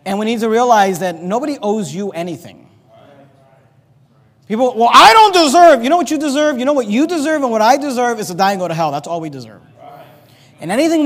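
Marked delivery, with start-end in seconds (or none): 4.8-5.8 loud, high indignant protest; mock complaint. Preacher mimicking people who feel owed, mock indignant. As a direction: imitate an entitled whiner, voice raised.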